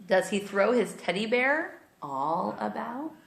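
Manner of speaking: put-on storybook read-aloud for little kids; reading to children. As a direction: read it like bedtime story time. Reads picture book aloud to kids.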